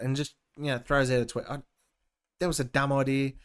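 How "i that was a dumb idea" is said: ordinary delivery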